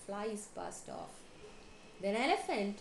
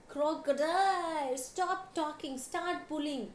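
raised, high bird's call; 7 semitones higher, 7 dB louder, slower than usual. A narrator's reading. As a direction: call out orders, stretching the first word. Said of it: "called Tick Bird".

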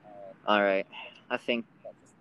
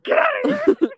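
high shrieking yell; panicked shout; 13 dB louder than usual. Retold or imitated. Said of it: "I'm like".

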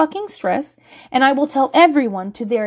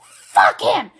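high-pitched mock shout at a kid; hammed-up furious parent outburst; acted parent yelling; 13 semitones higher, 4 dB louder than usual. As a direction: yell like a parent at the end of their rope. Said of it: "when you yell at your kid".

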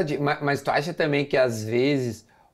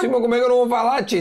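excited; 7 semitones higher, 3 dB louder, faster than usual. Real, not acted. Laughing protest about eating on air.

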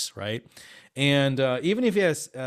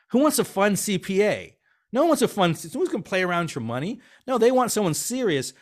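emphatic retold pitch; 8 semitones higher, 5 dB louder than usual. Retold heated pitch to client.

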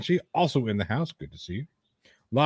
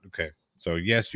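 usual speaking voice

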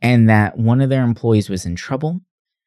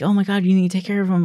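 fast, high-pitched fluster; mock freaking out; 10 semitones higher, much faster than usual. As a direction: imitate a panicked caller, rushing. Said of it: "the telemarketer's freaking out".

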